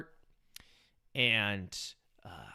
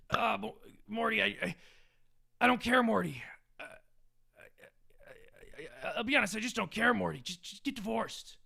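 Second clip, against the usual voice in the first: higher stammering voice, trailing to a near whisper, then a slow dismissive end; Rick impression; 6 semitones higher than usual. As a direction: careless mad scientist shrugging it off. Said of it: "doing a Rick and Morty impression".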